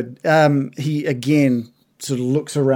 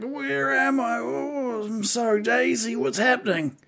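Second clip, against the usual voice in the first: high, drawn-out groggy creature voice; dazed bugbear; 10 semitones higher, 5 dB quieter than usual. Acted. Bugbear waking on the ground, dazed and groggy. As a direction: come round woozily, high and soft. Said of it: "dazedly just around the room".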